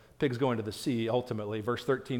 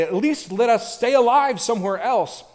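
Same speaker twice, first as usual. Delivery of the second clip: loud, high pleading demon; earnest begging; 9 semitones higher, 9 dB louder than usual. Acted. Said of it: "They begged him earnestly".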